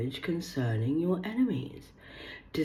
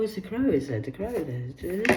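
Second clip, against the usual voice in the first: ordinary talk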